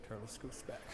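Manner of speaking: ordinary delivery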